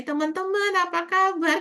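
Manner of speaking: high, bright kindergarten-teacher call to the class; childlike teacher greeting. Retold or imitated. Kindergarten teacher greeting her pupils, singsong high voice. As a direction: greet the little ones like a TK teacher. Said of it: "Kita ikutan begitu ngomongnya".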